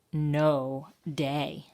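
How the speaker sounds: ordinary delivery, nothing marked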